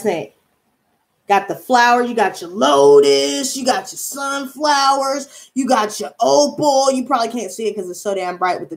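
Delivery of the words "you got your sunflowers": ordinary talk, nothing marked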